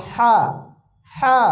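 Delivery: হা pushed from inside the throat; pressed throat sound. Shown as a demonstration. Teacher giving an example, throat-pressed হা. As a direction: tighten the throat on a breathy হা. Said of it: "গলার ভিতর থেকে চাপ দিয়ে".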